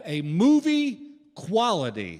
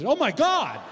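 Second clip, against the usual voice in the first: shocked outcry; 10 semitones higher, slower than usual. Pretend. Neighbor finds a surprise in his pictures, shocked outcry. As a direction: act out a startled neighbor's shriek.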